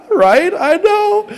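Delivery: high, laughing agreement; words breaking up into real laughter. Real, not acted. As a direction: share the joke, pitch shooting up with laughter. Hypnotist cracks up with audience.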